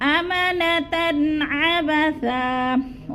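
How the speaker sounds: अमानतन अबसा slow, the ऐन said clearly, no nasal ghunna; slow recitation. Shown as a demonstration. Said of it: "ऐन को जाहिर करके पढ़ना है". (इजहार हल्की के साथ धीरे पढ़ना)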